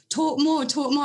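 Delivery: high-pitched imitation of keen admirers; eager mock urging. American fans begging host to talk, high eager imitation. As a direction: egg them on, playfully.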